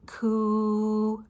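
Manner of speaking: "Coo" with a long, level oo; k gliding into a drawn-out oo in "Coo"; stretched qu sound. Shown as a demonstration. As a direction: hold "Coo" as one steady "kwooo". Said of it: "Q, U together says this".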